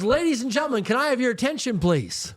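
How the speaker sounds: high, carrying public announcement to the whole room. Retold or imitated. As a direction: announce it to the packed restaurant, slowing on flat broke. Mentor announces to packed restaurant.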